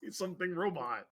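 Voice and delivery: flat, half-hearted robot voice. Acted. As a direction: mumble it like a lazy robot. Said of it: "No, more robotic".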